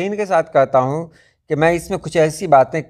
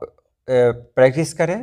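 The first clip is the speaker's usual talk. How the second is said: ordinary talk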